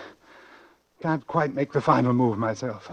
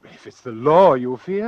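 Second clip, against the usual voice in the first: forceful; 9 dB louder than usual. Acted. Doctor presses the reluctant man.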